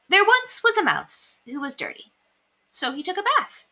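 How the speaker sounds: ordinary delivery, nothing marked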